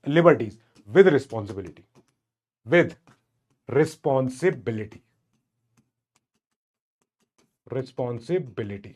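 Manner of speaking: word by word, drawn out with long pauses; slow dictation. Real, not acted. Teacher dictating liberties with responsibility to students, slow dictation.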